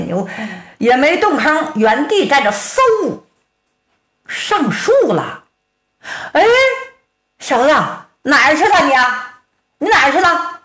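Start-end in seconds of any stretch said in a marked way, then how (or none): 6.3-10.6 higher, puzzled calling out; where did he go, call around; baffled calling. Acted. Giant searches for him.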